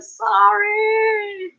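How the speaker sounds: high, put-on sheepish sorry; mock apology. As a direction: squeak out a pretend apology to a scolding judge. Imagined reply to Judge Judy's scolding.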